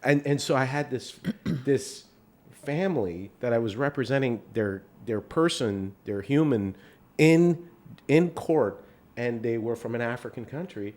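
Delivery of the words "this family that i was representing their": ordinary talk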